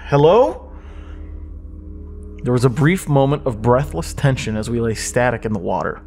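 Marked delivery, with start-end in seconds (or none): 0.1-0.7 nervous call. Acted.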